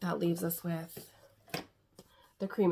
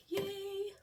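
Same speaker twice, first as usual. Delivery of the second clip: excited cheer; 12 semitones higher, 6 dB quieter than usual. Real, not acted. Cheering a finished palette.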